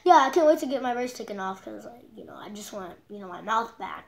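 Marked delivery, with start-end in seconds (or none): none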